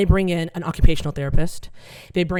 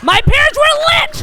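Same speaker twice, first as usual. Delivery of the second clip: much higher and louder, drawn out; punchline shout; 8 dB louder than usual. Real, not acted. Comic shouting the punchline at the audience, loud and high. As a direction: belt the reveal to the crowd.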